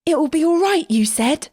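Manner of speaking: put-on high, furious boy; raging. A narrator's reading. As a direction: rage at his friends. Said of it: "Kion raged".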